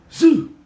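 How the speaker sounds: high loud spitting sound; loud spit noise. Retold or imitated. Comic acting out the spit hitting the cabman, loud high noise. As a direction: make the loogie land loud.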